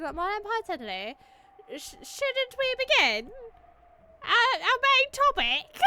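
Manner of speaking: stumbling into a giggle; high character voice. Acted. Character voice, breaks into laughter.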